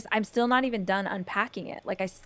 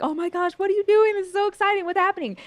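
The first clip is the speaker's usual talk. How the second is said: much higher, louder, gushing quote; excited boyfriend; 10 semitones higher, 4 dB louder, faster than usual. Retold or imitated. Mimicking boyfriend's excited reply, higher and gushing. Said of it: "He was like".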